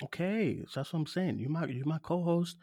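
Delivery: soft, backed-off reasoning; subdued. Real, not acted. Host calms things with his co-host, subdued. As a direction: drop the volume and make peace. Calming down after argument.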